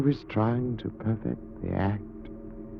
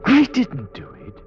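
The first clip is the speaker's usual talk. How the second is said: protest; 9 semitones higher, 4 dB louder than usual. Acted. Accused, protesting his innocence.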